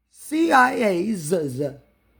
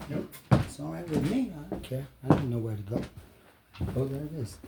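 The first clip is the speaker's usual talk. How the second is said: muttering to himself; 8 semitones lower, 14 dB quieter than usual. Real, not acted.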